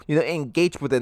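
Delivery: ordinary delivery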